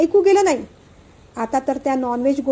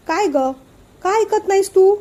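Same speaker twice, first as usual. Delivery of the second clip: higher, louder scold to the niece; angry scolding; 6 semitones higher, 3 dB louder than usual. A narrator's reading. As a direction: demand an answer, angry. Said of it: "Dadasaheb पण भडकले". (रागाने दटावत)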